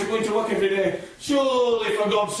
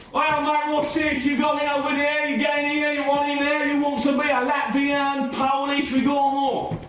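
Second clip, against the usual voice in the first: higher, chirpy London trader shouting his wares; cockney beer hawker; 3 semitones higher than usual. Acted. Trader calling to the crowd about free beer, chirpy cockney patter.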